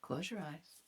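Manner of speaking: soft, low, coaxing instruction; mock seductive hush. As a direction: coax him gently, barely aloud.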